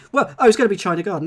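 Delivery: loud, rushed burst of realisation; sudden realisation. Real, not acted.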